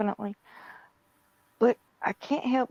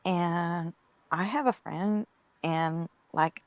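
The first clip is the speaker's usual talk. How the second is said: put-on Valley Girl; slower than usual. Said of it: "you do a perfect Valley Girl".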